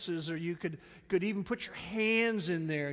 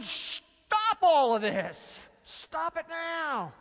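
slow, louder, stern command; imitating an irate Jesus; 10 semitones higher, 6 dB louder, much slower than usual. Jesus stopping the disciples, stern and slow. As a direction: halt them with slow firm anger. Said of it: "He's going".

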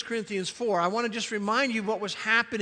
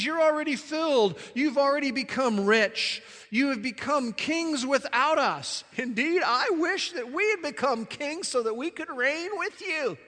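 higher, mock-admiring sarcasm; sarcastic; 5 semitones higher than usual. Preacher reading Paul's jab at the puffed-up Corinthians, sarcastic. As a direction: pile on false praise in a lifted voice. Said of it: "And again, being sarcastic".

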